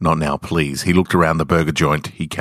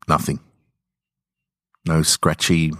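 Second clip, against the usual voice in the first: ordinary delivery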